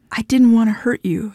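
plain reading voice

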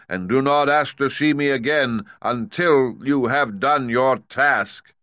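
loud, high, slow commanding giant-head voice; booming great head. Acted. Great head demanding payment from Dorothy, loud and stern. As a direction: lay down the law from the throne.

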